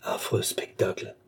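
hushed, toneless horror; horrified undertone. A narrator's reading. Old man seeing heaped corpses, horrified undertone.

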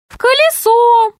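high, earnest little gnome; tiny gnome voice. Acted. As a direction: explain it like a small nervous gnome. (тоненький голос гнома)

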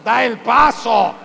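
slow, loud, booming command; voice of God. Acted. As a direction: thunder it out like God insisting. God's voice urging the believer. (Dios ordenando, lento y fuerte)

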